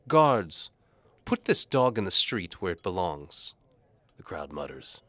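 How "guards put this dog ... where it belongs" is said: high, loud imperious order; Lady Antimony ordering him thrown out; commanding lady. A narrator's reading. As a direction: order the guards with contempt.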